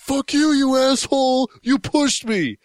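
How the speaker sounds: imitating a furious bus passenger; retold screaming. Retelling a bus passenger's outburst, mock angry yell. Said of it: "start screaming at him".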